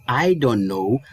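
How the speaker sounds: slow, linked English phrase; smooth slow sentence. Shown as a demonstration. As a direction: glide through it without stopping. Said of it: "eu levo direto".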